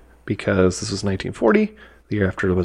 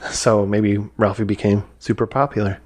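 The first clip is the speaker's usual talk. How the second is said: ordinary delivery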